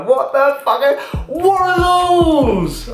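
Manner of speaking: very high, very slow mocking squeal; mocking onlooker. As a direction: drag it out high, as a disgusted passer-by. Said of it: "People might look at these and go".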